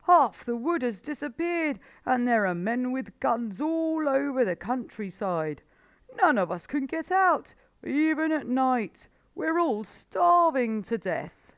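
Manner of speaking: high, loud, frantic old badger; a worn-out badger begging for help; desperate Badger. A narrator's reading.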